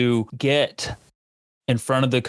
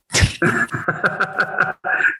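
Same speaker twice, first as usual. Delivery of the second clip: breathy laugh; 8 semitones higher than usual. Real, not acted. Laughs at the story.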